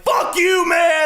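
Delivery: very high mock shout; retold screaming. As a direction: scream it like a brawler refusing to leave. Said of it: "they're screaming like motherfuckers".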